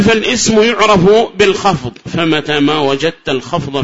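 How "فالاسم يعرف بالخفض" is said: high, loud formal text reading; reciting the text. Shown as a demonstration. Teacher quoting the grammar text to students, formal recitation. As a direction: read the primer line out in a raised voice.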